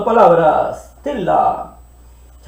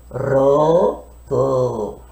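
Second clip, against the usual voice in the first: put-on lower, babyish roto; baby talk; 7 semitones lower, 3 dB quieter than usual. Shown as a demonstration. Teacher mimics a baby for the class. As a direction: say it like a little baby. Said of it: "Ya no decimos como los bebitos".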